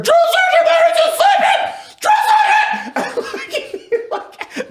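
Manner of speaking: very high, loud, slow mock shriek breaking into laughter; laughing impression of the private screaming from the monkey bars; hysterical screaming. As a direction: scream in terror while cracking up. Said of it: "He's like".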